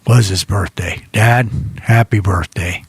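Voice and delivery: usual speaking voice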